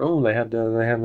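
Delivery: ordinary talk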